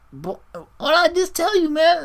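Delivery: a flustered teacher scolding his student in falsetto; exasperated mentor. Retold or imitated. All Might squeaking at Deku, exasperated. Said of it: "He's just like".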